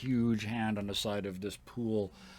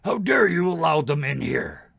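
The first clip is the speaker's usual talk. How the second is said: loud, raised-pitch roar of outrage; booming challenge from a giant; giant's bellow; 7 semitones higher, 10 dB louder than usual. Acted. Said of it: "bellow out".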